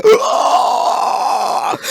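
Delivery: loud drawn-out "ugh"; comic groan of being sucked up; vacuumed groan. Acted. Carpet getting vacuumed, loud comic groan.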